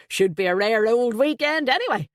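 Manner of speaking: loud, high, hearty Irish voice; a flustered, jovial Dubliner blurting out; Irish best man. Acted.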